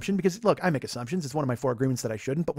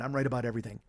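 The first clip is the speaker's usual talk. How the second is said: softer, grumbled under the breath; muttered to himself; 4 semitones lower, 9 dB quieter, faster than usual. Real, not acted. Host grumbling to co-host after losing the argument, muttered. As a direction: say it low, half to yourself. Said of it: "repeated insistence".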